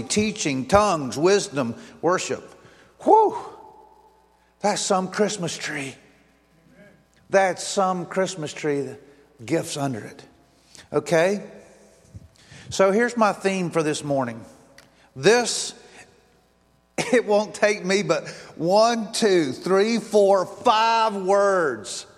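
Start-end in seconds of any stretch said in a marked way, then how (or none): 3.0-3.4 high loud exhale of relief; a breathless whistle of relief after the long list; whew. Real, not acted. 18.6-22.1 very slow counting; counting off the five words, drawn out slow. Real, not acted.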